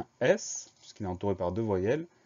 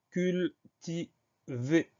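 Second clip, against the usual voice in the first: CULTIVER split into cul, ti, vé, slow and higher; syllable by syllable; 7 semitones higher, much slower than usual. Shown as a demonstration. Teacher announces the next word to pupils, syllable by syllable. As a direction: dictate "cultiver" in separated, held syllables. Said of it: "première syllabe".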